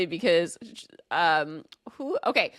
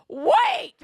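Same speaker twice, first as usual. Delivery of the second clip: high, loud startled cry of recognition; a shocked little yelp; sudden realization; 5 dB louder than usual. Retold or imitated. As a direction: snap awake to who she is. Sudden realization mid-story.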